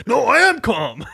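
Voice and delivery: high, loud shout that denies being upset; yelling I am calm. Retold or imitated. Angry customer yelling at the clerk that he is calm. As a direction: yell that you're calm, clearly not calm. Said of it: "yelling at somebody".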